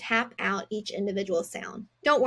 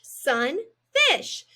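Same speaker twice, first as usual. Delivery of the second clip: "Sunfish" higher and louder, falling; cheerful reveal; 5 semitones higher, 4 dB louder than usual. Shown as a demonstration. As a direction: announce "Sunfish" as the finish. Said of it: "read these two words together".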